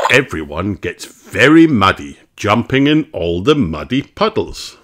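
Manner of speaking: ordinary delivery, nothing marked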